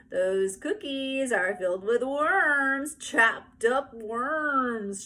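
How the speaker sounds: put-on high, slow, gleeful little-girl voice; drawn-out child's glee; gleeful Ramona. A narrator's reading. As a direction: gloat slowly over the gross news like a delighted kid. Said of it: "she gleefully told everyone".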